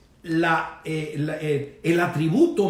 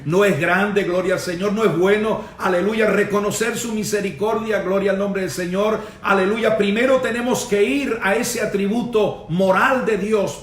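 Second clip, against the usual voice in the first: louder, driving exhortation; impassioned pulpit praise, pushed out hard; fervent preaching; 4 semitones higher, 4 dB louder than usual. Real, not acted. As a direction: rouse the congregation with rising fervor. Preacher rouses the congregation.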